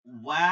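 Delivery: ওয়া loud, starting on a round w; rounded-lip ওয়া. Shown as a demonstration. Teacher showing rounded lips, loud round ওয়া. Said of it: "ঠোঁট গোল করিয়া".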